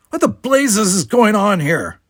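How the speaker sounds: high, slow, loud demand; calling out. A narrator's reading. Security guard breaks up the brawl, calling out. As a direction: bellow it over a crowd. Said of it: "the foremost guard was calling".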